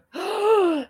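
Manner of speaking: high, sharp audience gasp; shocked gasp. Acted. Describing an audience stunned by the argument, shocked gasp. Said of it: "it just makes you go".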